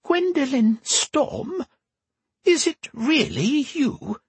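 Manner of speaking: put-on high, slow creaky old woman. A narrator's reading. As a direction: quaver it out like an aged empress. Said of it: "A creaky female voice rang out".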